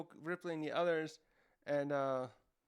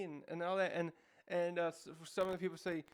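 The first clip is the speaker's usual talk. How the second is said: usual speaking voice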